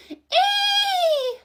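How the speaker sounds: put-on high, girly squeak of a skid; shrill-free high squeal, hammed up. Boyfriend skidding on spilt oil, girly squeal. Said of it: "a really girly noise".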